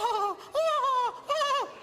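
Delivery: high gasp then slow delighted ohs. Retold or imitated. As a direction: gasp and coo like a thrilled wife. Wife delighted by surprise.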